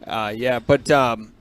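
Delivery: talking through a choking cough. Real, not acted. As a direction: push the words out while choking. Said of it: "I'm choking on".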